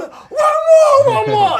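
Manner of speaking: high loud excited mock shout; mum cheering. Mum egging her son on with the fireworks. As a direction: cheer him on like a thrilled mum.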